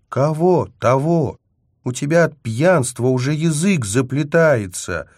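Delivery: high, loud challenge; scornful accusation. A narrator's reading. Angelina accusing him of drunken babbling, sharply reproachful. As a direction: pick at the drunkard's words.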